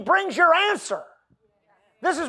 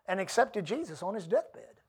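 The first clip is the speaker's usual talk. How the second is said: ordinary talk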